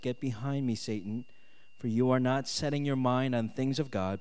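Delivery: ordinary talk, nothing marked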